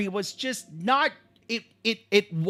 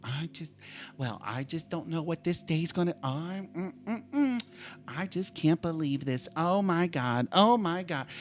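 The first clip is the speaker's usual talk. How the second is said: low, very soft imitated grumbling; quiet grumbling; 5 semitones lower, 10 dB quieter than usual. Mother complains to herself in the back, imitated low grumble. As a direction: mimic a sulky complaint under the breath. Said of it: "She's talking quietly because".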